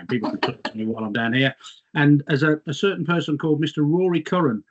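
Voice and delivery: usual speaking voice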